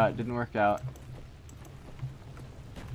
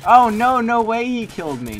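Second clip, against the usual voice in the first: much higher, very loud shriek of disbelief; outraged disbelief; 10 semitones higher, 12 dB louder than usual. Real, not acted. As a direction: scream at the unfair death. Outraged at getting killed.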